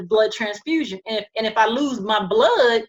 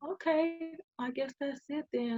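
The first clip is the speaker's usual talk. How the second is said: soft, higher, resigned acceptance; weak resigned voice; 6 semitones higher, 10 dB quieter than usual. Retold or imitated. Dying patient accepts her fate to the nurse, soft and resigned. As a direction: let go of it quietly and gently. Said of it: "this is exactly how I'm talking".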